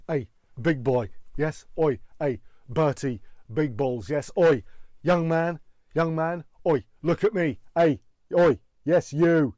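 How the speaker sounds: angry, annoyed barking; loud, punchy shouts for attention; Gordon Ramsay. Acted. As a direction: bark it out as an irate chef. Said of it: "sound angry and annoyed".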